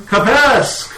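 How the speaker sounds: high, loud call of a name. Acted. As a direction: sing out the name like a sudden summons. Game master calls on player's character.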